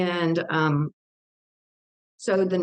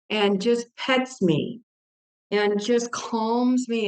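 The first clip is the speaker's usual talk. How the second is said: normal talking voice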